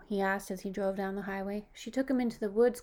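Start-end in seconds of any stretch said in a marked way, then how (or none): none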